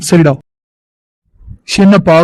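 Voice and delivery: ordinary talk, nothing marked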